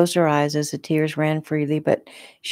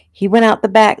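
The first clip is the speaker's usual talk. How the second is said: raised, louder urgent voice; urgent report; 5 semitones higher, 7 dB louder than usual. A narrator's reading. Victim directing the officer after her attacker, urgent. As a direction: tell it fast-on-the-heels, higher and louder.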